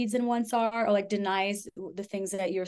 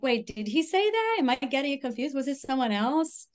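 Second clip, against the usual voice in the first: much higher, anxious questioning; confused self-doubt; 5 semitones higher than usual. Retold or imitated. Inner voice doubting herself.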